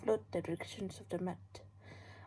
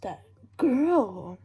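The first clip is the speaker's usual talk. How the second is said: loud; 3 semitones higher, 14 dB louder than usual. Acted. Pointing her out in the fight.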